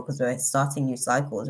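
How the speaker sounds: ordinary talk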